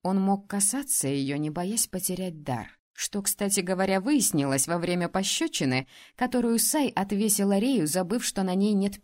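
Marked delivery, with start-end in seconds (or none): none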